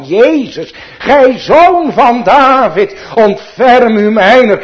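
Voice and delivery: loud, high desperate plea, slowed; crying out. Retold or imitated. Blind Bartimaeus calls out to Jesus, loud pleading cry. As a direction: call out to Jesus like a blind beggar begging for mercy. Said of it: "hij riep".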